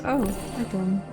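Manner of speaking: normal talking voice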